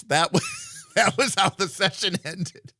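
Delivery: normal talking voice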